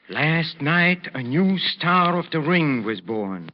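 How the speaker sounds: proud newspaper recital. Acted. Maestro reciting a fight write-up to Ricky, proudly declaimed. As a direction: stretch the fighter's name, swelling to the climax. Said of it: "Remember what the newspaper said?".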